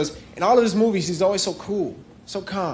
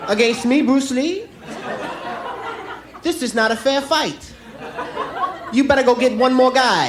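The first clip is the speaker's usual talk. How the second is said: high, cocky martial arts hero voice; cool Bruce Lee; 7 semitones higher, slower than usual. Retold or imitated. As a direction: play the unbothered kung fu star. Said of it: "says something real cool like".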